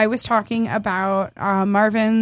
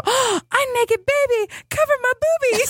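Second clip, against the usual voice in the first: imitating a toddler; 13 semitones higher than usual. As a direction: play a two-year-old being modest. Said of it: "she'll go".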